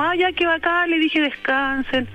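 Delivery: light approving reply. Retold or imitated. Telling the sister to go and rest, light and approving. Acts out her cheerful reply.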